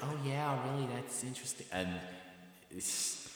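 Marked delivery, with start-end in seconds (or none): 0.0-1.7 higher-pitched mock interest; impressed listeners. Locals taking in the made-up London facts, mock fascination. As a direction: lightly imitate a fascinated listener. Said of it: "They're like".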